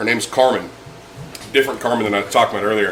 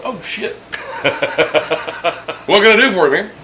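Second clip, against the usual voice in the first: laughing surprise; 7 semitones higher than usual. Retold or imitated. Laughing at discovering the midget.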